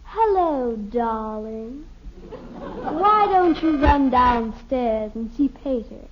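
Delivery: little sister aping a grand lady, slow and loud; mock aristocratic. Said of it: "She talks like this".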